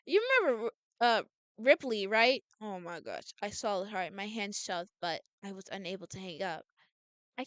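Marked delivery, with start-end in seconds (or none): none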